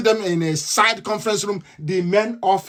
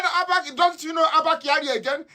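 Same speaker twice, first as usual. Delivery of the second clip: mock incredulous question; 8 semitones higher than usual. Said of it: "He said".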